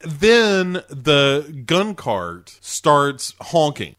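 ordinary talk

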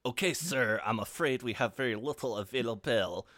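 hammed-up French accent on lilting English; attempted French-international accent. Hotel receptionist tells a guest what room is available, comic French accent. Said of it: "I'm gonna attempt this accent".

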